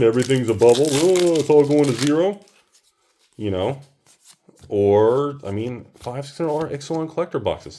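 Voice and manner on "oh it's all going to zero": a lightly put-on whiny naysayer voice; mocking online doomsayers. Mocking the online crowd who call everything a bubble, lightly whiny. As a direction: mimic the internet doom crowd, a little louder. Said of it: "Like everyone says online".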